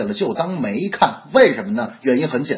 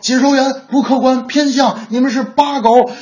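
indignant fan, pitched much higher; mock outrage; 9 semitones higher, 3 dB louder than usual. Real fan abusing commentators in comments, mock outrage. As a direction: chant the accusation like a rival heckler.

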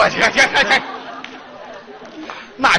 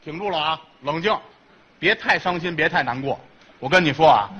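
consolazione finta, molto più dolce e bassa; mock consoling; 7 semitones lower, 4 dB quieter than usual. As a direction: consola piano, come a un bambino. Consoling weeping partner.

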